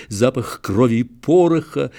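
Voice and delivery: ordinary delivery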